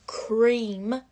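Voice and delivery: cream pushed out loud and breathy with a rising tune; emphasised cream. Shown as a demonstration. Vlogger telling viewers cream matters, loud breathy emphasis. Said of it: "again, emphasising the word cream".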